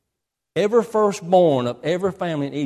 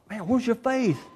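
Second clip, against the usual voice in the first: high-pitched, baffled question; mildly exasperated disbelief; incredulous challenge; 6 semitones higher than usual. Retold or imitated. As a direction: call out their doubt, pitched up. Preacher challenges the doubters.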